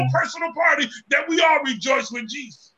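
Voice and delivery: rising, shouted gospel preaching; preaching at full force. Real, not acted. Preacher shouting to the congregation about heaven's party. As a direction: preach it out, building to a cry.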